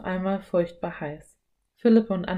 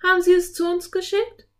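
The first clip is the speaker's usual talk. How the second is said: put-on high, louder curious girl; young girl's voice; 12 semitones higher, 6 dB louder than usual. A narrator's reading. Anne questioning the enchantress, high girlish voice. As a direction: ask in a high child's voice.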